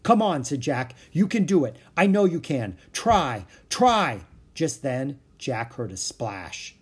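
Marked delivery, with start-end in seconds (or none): 0.0-0.4 loud, high push to keep going; urgent urging. Acted. Jack urging Annie out of the mud, urgent call. Urging sister through a rescue. 1.1-4.2 loud, raised, insistent urging; urgent encouragement. Acted. Jack urging sinking Annie to try, urgent encouragement. Urging sister through a rescue.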